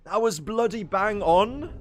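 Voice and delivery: joking posh British praise of himself; mock British. Streamer praising his own impression in mock British. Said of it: "mocking own British attempt".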